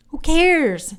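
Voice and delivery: breezy second brush-off; dismissive repeat. Real, not acted. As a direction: toss it off, already over it.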